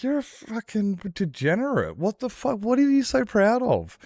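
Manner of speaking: a grandson berates his grandpa, high and outraged; disgusted scolding. Retold or imitated. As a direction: tear into him for being proud of violence. Scolding grandpa in imagined retort.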